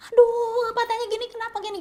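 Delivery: retold furious wife, voiced high; imitated screaming wife. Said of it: "teriak ngamuk dah istrinya".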